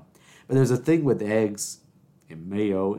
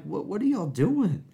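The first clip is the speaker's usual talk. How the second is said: high-pitched comic disbelief; exaggerated, retold bewilderment; baffled protest; 9 semitones higher than usual. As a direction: ask it way up high, as if utterly baffled.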